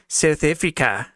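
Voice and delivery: put-on slow, drawn-out try at a South African accent; tentative South African accent; attempted South African accent. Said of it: "Trying to get that accent down".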